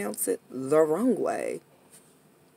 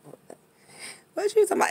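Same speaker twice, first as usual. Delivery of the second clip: high, baffled what; incredulous friend; 14 semitones higher, much faster than usual. Retold or imitated. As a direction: sound totally baffled. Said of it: "They be like".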